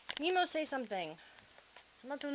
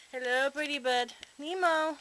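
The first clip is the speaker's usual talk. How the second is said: high, louder sing-song to the bird; high coaxing call; 5 semitones higher, 6 dB louder, slower than usual. Real, not acted. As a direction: coax the parrot in a raised bird-talk voice. Calling the parrot to talk.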